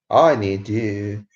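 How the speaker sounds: stretched out, lingering on each word; slow, half-joking appeal; slow plea. Real, not acted. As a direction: drag it out like a mock lament. Begging viewers to chat.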